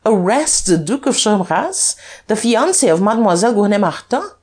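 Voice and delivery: high, ringing, incredulous questions; haughty defiance. A narrator's reading. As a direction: reel off your titles as a dare. Duke haughtily dares detective to arrest him.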